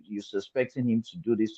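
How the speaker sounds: ordinary delivery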